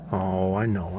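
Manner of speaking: normal talking voice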